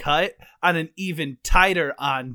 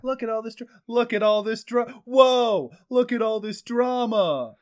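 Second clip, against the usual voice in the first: put-on higher, lightly accented dad voice, building; a dad spotting drama, surprised; dad catchphrase; 6 semitones higher, 3 dB louder than usual. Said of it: "the best version we've done".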